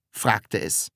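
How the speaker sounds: ordinary delivery, nothing marked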